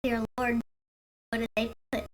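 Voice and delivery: ordinary delivery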